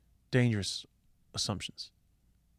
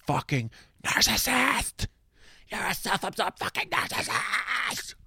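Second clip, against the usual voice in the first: high-pitched, slow, drawn-out mock rage; mock furious screech; 10 semitones higher, slower than usual. Partner yelling an insult mid-argument, high mock screech. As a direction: shriek the insult at your partner like mid-fight.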